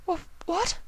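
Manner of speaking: very high, soft stammer; stunned. Acted. Octavia shocked by Vinyl's confession. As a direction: caught off guard.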